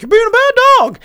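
high, loud Mickey Mouse falsetto; Mickey scolding Pluto in a high falsetto. Retold or imitated. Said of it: "and he's like".

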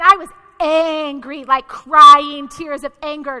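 ANGRY drawn out and punched loud; emphatic anger. Retold or imitated. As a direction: lean hard on "angry" and "crying", stretched out. Recounts her fury, leaning on words.

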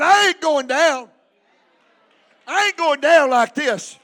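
full-force preacher's shout; defiant church holler; rallying shout. Shown as a demonstration. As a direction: belt it out for the congregation to echo. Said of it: "open your mouth right now and shout".

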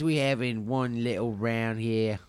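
ordinary talk

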